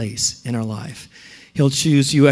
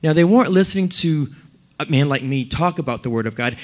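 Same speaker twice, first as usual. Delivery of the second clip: ordinary delivery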